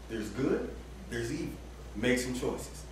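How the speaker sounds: a low, quiet voice of God setting the terms; God's voice. Retold or imitated. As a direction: lay out the choice deep and hushed. God voiced offering choices.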